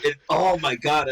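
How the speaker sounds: ordinary talk, nothing marked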